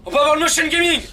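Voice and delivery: very high, loud squealed announcement; defiant mock yell. Spoiler blurted out despite the protest, defiant mock yell. Spoiler yelled out after being scolded.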